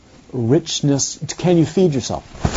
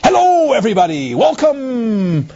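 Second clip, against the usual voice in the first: thespian actor voice; 9 semitones higher than usual. Retold or imitated. Actor greeting everybody with a grand theatrical welcome. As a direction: ham it up like a Shakespearean actor. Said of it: "thespian voice, of the Shakespearean".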